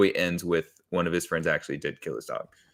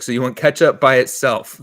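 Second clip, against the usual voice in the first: louder, higher, disbelieving question; acted incredulity; 4 semitones higher, 8 dB louder than usual. Cashier questions a customer's ketchup order, acted incredulity.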